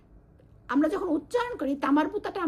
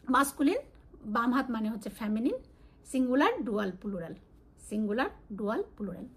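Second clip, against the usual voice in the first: usual speaking voice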